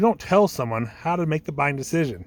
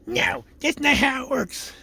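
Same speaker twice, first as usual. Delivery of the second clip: high, drawn-out mock gatekeeper; hammed-up unhelpful receptionist; obstructive gatekeeper; 9 semitones higher, slower than usual. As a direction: shoot the caller down in a slow, high voice. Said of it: "the gatekeeper shoots you down like that".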